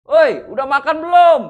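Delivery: high, loud long-distance shout; calling out loudly. Acted. Hill dweller asking a faraway neighbour about eating, shouting. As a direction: holler it across the hills. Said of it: "ngomongnya bisa teriak-teriak gitu".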